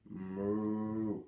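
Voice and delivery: bellowing moo. A narrator's reading. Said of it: "let out a loud, bellowing moo".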